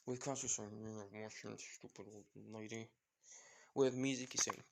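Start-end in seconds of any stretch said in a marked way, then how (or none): 0.8-2.9 very soft grumble; muttered insult. Acted. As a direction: mumble it under your breath. Son insults mother under breath.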